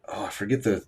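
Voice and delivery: usual speaking voice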